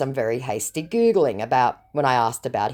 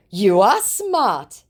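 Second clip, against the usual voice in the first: mock scammer flattery; 6 semitones higher, 5 dB louder, much slower than usual. Reading scammer's flattery aloud.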